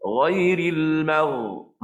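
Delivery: slow chanted "Ghairil magh-", unrounded gh; ghain sederhana. Shown as a demonstration. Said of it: "tara tu je". (bacaan "Ghairil magh-" perlahan, tidak berlebihan)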